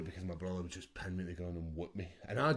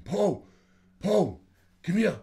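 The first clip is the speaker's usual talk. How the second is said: higher, louder calls from the crowd; crowd shouting; 10 semitones higher, 10 dB louder, slower than usual. Retold or imitated. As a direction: yell for Paul from the stands. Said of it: "guys in the crowd shouting".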